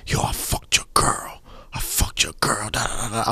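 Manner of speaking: breathy whispered threat. Retold or imitated. Caller voiced in story.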